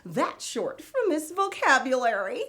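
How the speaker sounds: high, loud, giggly show host character; Miss Vocab. Acted. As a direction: play a bubbly kids' show host, laughing. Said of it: "I'm Miss Vocab".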